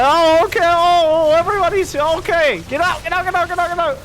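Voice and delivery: loud, high relieved yelling; overjoyed shout. Real, not acted. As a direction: cheer as everyone's okay. Units survive, frantic again.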